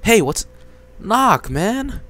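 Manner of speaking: loud high protest; indignant. Acted. Teen yelling at sibling walking in, indignant and loud. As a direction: snap at the intruder.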